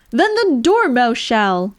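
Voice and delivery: loud, slow eager cry; two tea guests cry out the Dormouse's name; cried out. A narrator's reading. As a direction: call it out together, insisting. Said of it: "they both cried".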